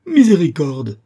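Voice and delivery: loud, high, theatrical wail; dismayed cry. A narrator's reading. Usurer making false complaints, loud dismayed cry. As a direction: cry out in put-on distress. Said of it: "s'écria Isaac, dont les fausses doléances".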